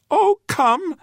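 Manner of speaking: very high, tiny creature voice; quavering plea. A narrator's reading. Little creature begging the man to follow, quavering. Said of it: "said the little creature, quavering".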